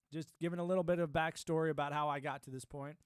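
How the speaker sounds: ordinary delivery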